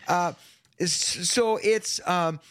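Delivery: ordinary delivery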